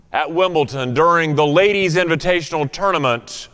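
ordinary delivery

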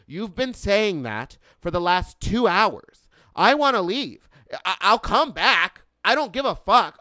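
loud, high, exasperated outburst; angry yelling. Retold or imitated. Customer demanding to leave after two hours, angry yelling. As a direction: re-enact losing it, punching every phrase. Retold outburst at dealership staff.

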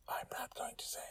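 breathy, secretive whisper; teasing whisper. Real, not acted. Narrator teases listeners about the hashtags in a whisper.

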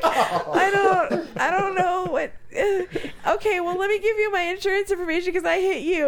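laughing through words. Real, not acted. Laughing while retelling own reply.

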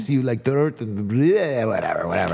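put-on weak, half-hearted Indian accent. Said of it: "I can't even do a fucking Indian accent".

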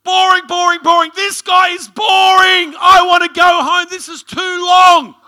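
imitating his son hollering in protest, high and very loud; drawn-out mock kid shouting; bored child's outburst. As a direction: yell it like a small boy who has had enough. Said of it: "my son stands up".

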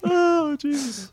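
laughing. Real, not acted. Exhausted from laughing.

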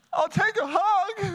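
high, slow tearful plea; asking his wife for a hug through tears; retold crying. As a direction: say it like you're still crying. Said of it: "bawling my head off".

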